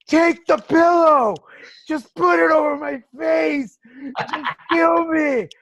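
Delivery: high, loud, slow sobbing plea; crying plea. Retold or imitated. Begging her husband to end it, high crying plea. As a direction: beg through tears, desperate. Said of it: "but crying".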